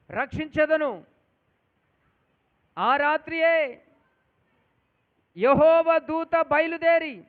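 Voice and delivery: full-force preacher's cry; fervent preaching. Acted. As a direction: proclaim it to the whole church.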